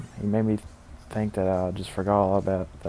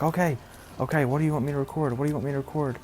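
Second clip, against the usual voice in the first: higher, rushed, imitated eagerness; eager agreement; 6 semitones higher, much faster than usual. Henry asking the boss what to record, eagerly. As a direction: play an over-eager helper jumping at it.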